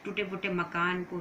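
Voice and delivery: normal talking voice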